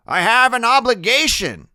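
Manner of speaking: loud, stubborn grandfather; grandfather arguing. A narrator's reading. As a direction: argue back, short of a scream. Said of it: "Grim's voice isn't nearly as loud".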